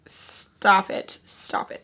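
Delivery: slow, drawn-out whispered plea; disgusted whisper. Real, not acted. Telling apologising men to stop, disgusted whisper. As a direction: whisper it as if cringing hard.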